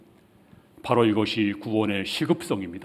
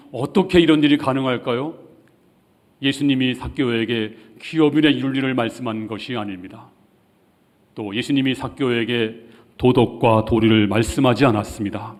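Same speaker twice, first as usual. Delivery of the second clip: normal talking voice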